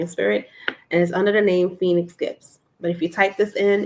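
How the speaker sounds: ordinary talk, nothing marked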